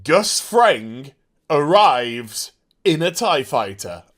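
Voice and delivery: very slow, word by word; slow, savoured announcement. Real, not acted. Fan saying the line he needed, slow savoured announcement. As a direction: savour each word with pauses. Delivers the long-awaited line.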